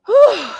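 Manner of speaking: a big, high, loud blow of breath; loud winded whew. Real, not acted. Gardener winded from work, loud high whew. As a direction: let out a spent whew. Winded after hauling fertilizer.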